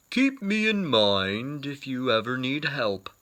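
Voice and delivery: gently voiced bear offering help.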